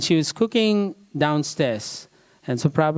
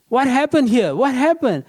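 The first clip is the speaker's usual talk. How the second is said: much higher and louder, bursting into the room; alarmed demand; 10 semitones higher, 4 dB louder than usual. Retold or imitated. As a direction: demand an answer as you barge in. She barges in and say.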